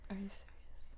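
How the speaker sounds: real hushed disbelief; shocked whisper. Real, not acted. Friend hearing about the hospital, shocked whisper. Listener shocked by birth story.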